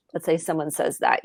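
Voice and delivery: ordinary talk, nothing marked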